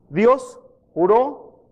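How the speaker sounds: normal talking voice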